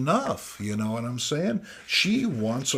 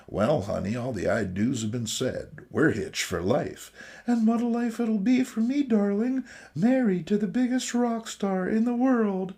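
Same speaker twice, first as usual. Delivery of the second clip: high, breathy-free swooning bride voice, pitched up at the end; mock romantic heroine; comic heroine voice; 9 semitones higher than usual. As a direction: gush like a lovesick comic-book wife. Reading romance comic dialogue aloud.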